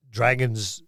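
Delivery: normal talking voice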